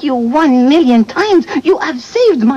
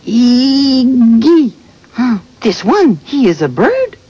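put-on puzzled English with a thick Québécois accent; French-Canadian accent; 2 semitones lower than usual. Bird puzzled by talking friends, French-Canadian accent. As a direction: echo the strange question in broken English. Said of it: "Why does she talk like that?".

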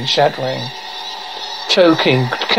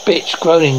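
normal talking voice

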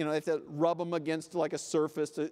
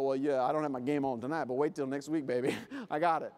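lower, quick, cocky shrug; easygoing mock confidence; breezy optimist; 7 semitones lower, faster than usual. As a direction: brush it off with playful swagger.

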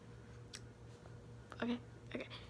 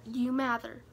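ordinary talk

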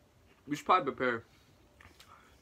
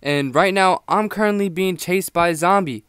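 loud; 10 dB louder than usual. Real, not acted. Vlog intro while being chased.

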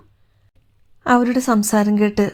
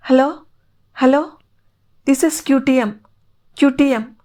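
radio calling; 5 semitones higher, slower than usual. Acted. Radio contact lost, calling again.

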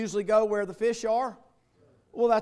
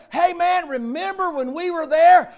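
loud, high joyful call; excited reminiscing; 9 semitones higher, 12 dB louder than usual. Retold or imitated. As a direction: greet an old friend with joy. Friends recalling worship together.

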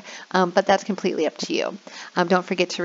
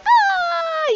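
put-on kids' squeal. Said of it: "they're like".

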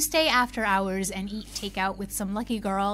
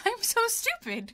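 laughs at herself, pitch jumping high; a pained self-mocking laugh; embarrassed laugh at her own mistake; bitter laugh; 12 semitones higher than usual. Real, not acted. Realizing the strap was Audrey's.

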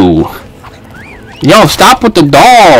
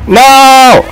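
sharp shout; 12 semitones higher than usual. Real, not acted. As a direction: cry out at the hit. Shout at game.

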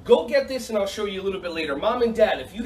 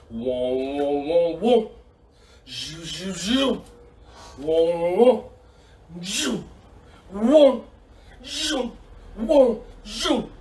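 laser sound effects; much slower than usual. Pretend. Coach firing two pretend lasers, alternating sound effects. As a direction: trade "whoa" and "zhou" laser noises.

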